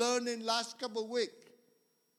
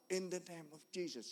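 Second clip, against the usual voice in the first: quiet, softer prayer under his breath; silent rebuke; 2 semitones lower, 7 dB quieter, faster than usual. Retold or imitated. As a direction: murmur the name of Jesus.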